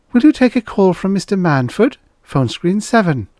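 secretary. A narrator's reading. Secretary announces a call to the producer, high-voiced. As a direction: pitch it well up for the secretary.

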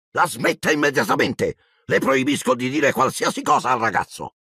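high-pitched panicky prohibition; shrilly indignant command; Zio Vernon, ordering. A narrator's reading. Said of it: "zio Vernon ritrovò la voce".

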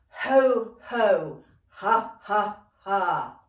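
loud, punchy chant on the breath; with conviction. Shown as a demonstration. Dance teacher leading a warm-up chant, with conviction. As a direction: let each syllable burst out with the breath. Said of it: "say that with real conviction".

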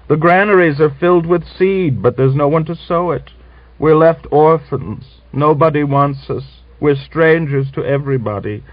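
high, tremulous widow's lament; tearful lament. Acted. Mother-in-law lamenting to Grisha through tears. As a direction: plead through tears over the lost son. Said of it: "greeted them with tears".

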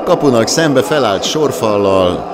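loud, high goal call; excited Hungarian sports commentary. Real, not acted. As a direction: roar the goal, then keep it loud.

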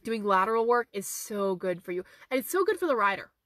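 ordinary talk

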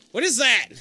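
high, loud demand; alarmed shout. Real, not acted. Player asks Tyler what he is doing, alarmed shout. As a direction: shout it at your teammate.

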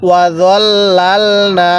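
long drawn-out chanted recitation, every vowel stretched; slow Quran recitation. Shown as a demonstration. Teacher models the verse for students, slow drawn chant. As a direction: intone it slowly, as the teacher's model reading. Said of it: "হ্যাঁ, কিভাবে পড়ব?".

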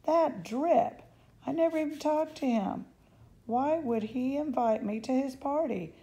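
high, loud disgusted outburst; indignant. Acted. Girl ranting about the boy who invited her, high and loud. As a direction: scoff at the boy loudly.

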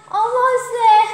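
ordinary delivery

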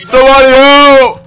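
yelled call for help. Pretend. Player beaten in game calls teammates, yelling. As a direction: holler for rescue, stretching it out. Calling for help while being attacked.

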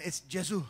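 Gesù said the Italian way, jeh-ZOO; Italian stress on the end of Gesù; quiet Gesù in Italian. Shown as a demonstration. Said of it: "In Italian".

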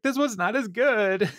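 high-pitched imitation of someone about to cry; mock tearful. [name] trying another shrimp, mock tearful. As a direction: let it climb into a comic whimper. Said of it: "He starts crying".

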